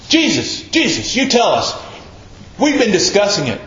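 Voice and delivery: high-pitched imitation of squabbling disciples; mock grousing. Preacher imitating disciples griping to Jesus, mock grousing. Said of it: "Quit grousing".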